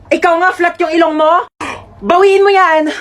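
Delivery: offended high retort; indignant demand. Acted. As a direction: snap back at the insult. Sibling quarrel in skit.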